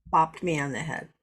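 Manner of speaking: ordinary delivery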